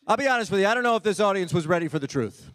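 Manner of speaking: ordinary delivery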